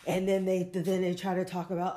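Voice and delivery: ordinary talk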